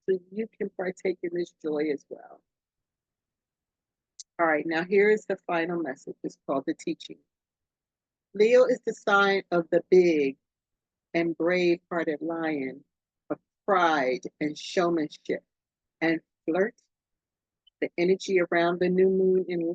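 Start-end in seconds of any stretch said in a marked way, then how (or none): none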